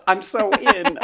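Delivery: laughing high and loud. Real, not acted. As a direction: burst out laughing. Laughs while saying it.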